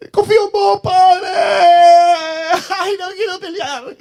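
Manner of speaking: quejido agudísimo que se desinfla; cristiano cobarde. Acted. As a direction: parodia chillona de un creyente que no quiere pelear. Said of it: "Ahí está el cristiano". (lloriqueo fingido y muy agudo)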